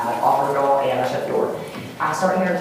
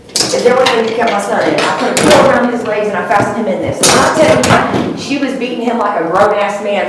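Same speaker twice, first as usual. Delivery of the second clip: real distress, louder and higher; agitated testimony; 4 semitones higher, 7 dB louder than usual. Real, not acted. Witness telling of her son being beaten, agitated. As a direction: insist on it, voice rising and getting louder.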